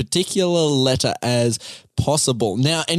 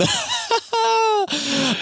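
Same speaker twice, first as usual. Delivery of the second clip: squeaky burst of laughter; high squealing laugh. Real, not acted. Laughs at his failure.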